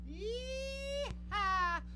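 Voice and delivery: high whooping cowboy yell; yee-haw whoop. Acted. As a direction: whoop it out with joy. Show intro whoop.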